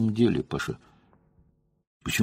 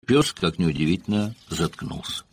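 plain reading voice

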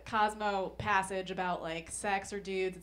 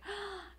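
dramatic gasp; 11 semitones higher, 8 dB quieter than usual. Pretend. Mock shocked quiz reaction.

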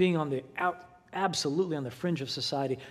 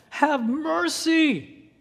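much higher and louder, stretched very slowly; desperate cry; 12 semitones higher, 7 dB louder, much slower than usual. Acted. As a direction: wail it out as a last hope. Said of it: "in desperation".

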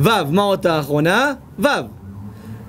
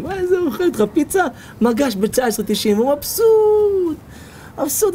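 mock content friend; 9 semitones higher than usual. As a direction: play the easily pleased friend, much higher.

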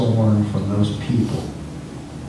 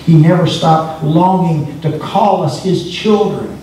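raised, emphatic preacher's delivery; impassioned preaching; 6 semitones higher, 5 dB louder than usual. Real, not acted. Preacher telling the congregation God never gave up, impassioned preaching. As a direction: preach it with rising fervor, pitched up.

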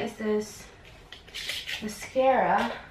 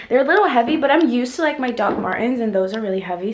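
excited; 2 semitones higher, 10 dB louder, faster than usual. Real, not acted. Trying on the shoes.

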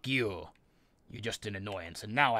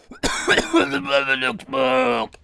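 mock voice; 14 semitones higher, 7 dB louder, much slower than usual. Mocking an enemy in game.

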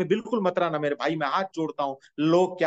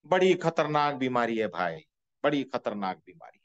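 ordinary delivery

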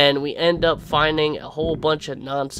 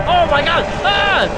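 shrieking jackpot winner; 13 semitones higher, 7 dB louder than usual. Real, not acted. Jump scare by monster.

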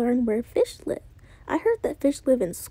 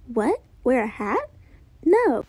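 plain reading voice

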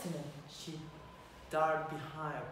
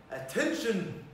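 cried out; 3 semitones higher, 3 dB louder than usual. Acted. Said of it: "he cried".

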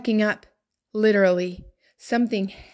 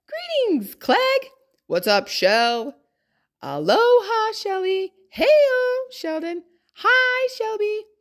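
much higher, bright cheerful hellos to each friend; excited greetings; 10 semitones higher than usual. Acted. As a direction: greet every egg like a long-lost pal. Egg greets each friend home.

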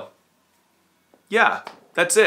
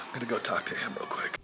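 unvoiced whisper; 4 semitones lower, 12 dB quieter, faster than usual. Real, not acted. Vlogger whispers near sleeping puppies. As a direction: whisper so the puppies stay asleep.

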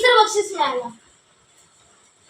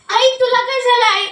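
normal talking voice

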